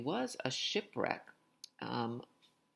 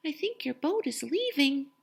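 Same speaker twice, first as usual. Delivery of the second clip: much higher, teasing little voice; cheeky messenger; 7 dB louder than usual. Acted. Messenger Little Red telling the owner his boat is leaving, high and cheeky. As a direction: tease the angry owner sweetly. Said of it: "Little Red stepped up and said".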